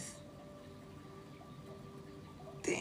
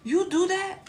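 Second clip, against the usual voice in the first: higher, louder mock scold at the cat; 9 semitones higher, 4 dB louder than usual. Owner scolding her cat after the bath, mock angry. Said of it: "I was like".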